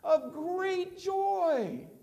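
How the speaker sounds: Of great joy sung out very high and very slow; drawn out. Acted. Preacher quoting the angel, high and drawn out. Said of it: "What else does he say".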